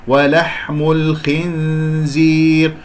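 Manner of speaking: slow, drawn-out tajweed recitation; model recitation. Shown as a demonstration. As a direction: recite it clearly and slowly as the model. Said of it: "هكذا بارك الله فيكم". (تلاوة نموذجية بطيئة)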